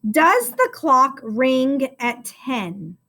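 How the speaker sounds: slow, clear dictation of the sentence. Shown as a demonstration. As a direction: read each word slowly for the class to write. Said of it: "Let's write the sentence".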